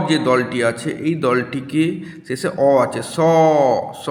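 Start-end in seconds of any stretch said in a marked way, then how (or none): 3.0-4.1 স, স, each vowel held long; two sustained, open স syllables; long drawn-out syllable. Shown as a demonstration. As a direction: stretch each স as far as it will go. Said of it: "অনেকটা টান দিয়ে উচ্চারণ করতে পারি".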